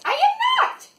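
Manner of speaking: very high loud denial; squeaky protest. Real, not acted. Reader protesting to the kids, squealing.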